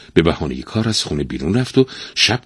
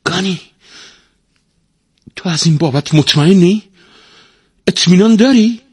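high-pitched, increasingly loud questioning; merchant's incredulous raised voice; astonished; 10 semitones higher, 8 dB louder than usual. A narrator's reading. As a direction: press the parrot in disbelief, voice climbing. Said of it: "با تعجب گفت".

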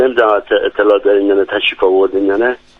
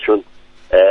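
ordinary talk

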